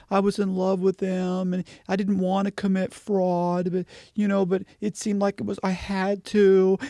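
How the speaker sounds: high, singsong imitation of a woman pleading innocence; mock victim woman. Mocking his ex's excuses in her interview, high mock-victim voice. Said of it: "So that was her whole thing".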